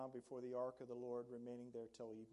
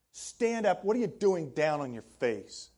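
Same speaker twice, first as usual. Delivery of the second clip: God sharply rebuking Joshua; stern rebuke; 21 dB louder than usual. Acted. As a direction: order him up off the floor. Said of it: "that does not sound like a good intro from God".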